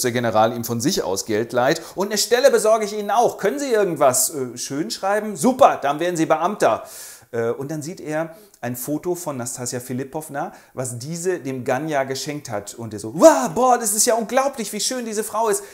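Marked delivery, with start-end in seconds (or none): 1.9-6.8 higher, loud jovial general; a hint of a bluff general; hearty general. General bietet Stelle an. 13.1-15.6 high, loud gasp of wonder; awestruck wow. Acted. Fürst sieht Foto, staunt.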